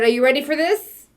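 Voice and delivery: usual speaking voice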